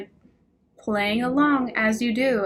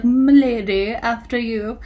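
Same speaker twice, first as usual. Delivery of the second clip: lightly voiced over-courtly gentleman; hint of a pompous suitor, "M'lady" pushed louder; mock gallant; 5 dB louder, slower than usual. Mocking a would-be gallant man.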